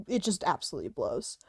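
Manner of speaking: usual speaking voice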